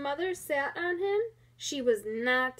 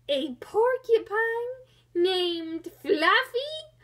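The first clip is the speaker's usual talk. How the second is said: louder, higher, slow and laughing; howling with laughter; 6 semitones higher, 5 dB louder, slower than usual. Acted. Rhinoceros mocks the porcupine's name, howling with laughter. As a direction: howl the question through laughter. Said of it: "howled the rhinoceros".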